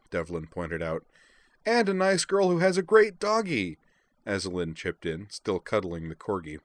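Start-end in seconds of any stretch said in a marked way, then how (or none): 1.6-3.8 high, loud and excited; gushing girlish voice. A narrator's reading. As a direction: squeal it happily about the doggy. Azalin chips in, cuddling the corgi.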